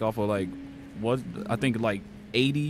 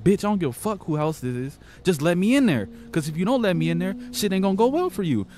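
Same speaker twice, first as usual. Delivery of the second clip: retold angry threat; 7 semitones higher than usual. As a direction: act out the stranger's threat in a raised voice. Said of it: "He was like".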